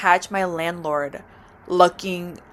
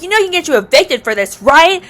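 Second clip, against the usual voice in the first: higher, louder scolding; landlord going off; 8 semitones higher, 4 dB louder than usual. Retold or imitated. Landlord scolding the tenant, higher loud scolding. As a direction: mimic her tearing into you. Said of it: "going off on me".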